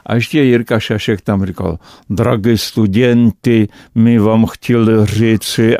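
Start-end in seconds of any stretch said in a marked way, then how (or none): 2.1-5.8 slow, imitated Russian accent. Colleague on stage addressing students, imitated Russian accent. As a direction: mimic a Russian accent, drawing the words out. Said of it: "a ty s tím ruským přízvukem".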